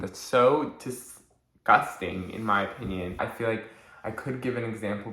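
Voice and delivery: normal talking voice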